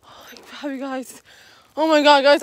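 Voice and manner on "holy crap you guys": whispered in fright; a breathless scared whisper; frightened whisper. Real, not acted. Vlogger just spooked, whispering in fright. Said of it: "I just got scared right now".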